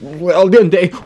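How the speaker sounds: shrill mock Italian demon. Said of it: "That's the Italian voice".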